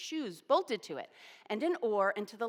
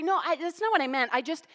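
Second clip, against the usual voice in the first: higher, louder, tripping over words; flustered stammer; 7 semitones higher, 7 dB louder than usual. Retold or imitated. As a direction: scramble to explain yourself, caught out. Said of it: "And I stammer".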